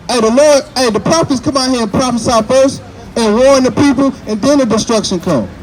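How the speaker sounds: loud, high street-preacher delivery; preaching. Real, not acted. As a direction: preach it out to the crowd.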